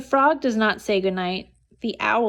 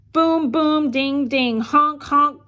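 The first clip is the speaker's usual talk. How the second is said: band noises; 4 semitones higher, 4 dB louder, slower than usual. A narrator's reading. One-bean band noises.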